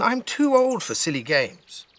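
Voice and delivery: put-on high, slow, scornful little girl; child scoffing at a silly idea, high and slow. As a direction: drag out the scorn like a bored child. Nora scoffs at grandma.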